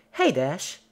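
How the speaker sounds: high, loud, sing-song call; piping Pinky. Acted. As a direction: call out brightly. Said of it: "Pinky piped up".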